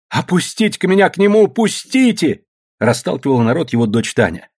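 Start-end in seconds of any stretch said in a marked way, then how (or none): 0.1-2.4 high-pitched, raised-voice demand; urgent demand. Acted. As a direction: shout your way through the crowd. Daughter pushes through the crowd.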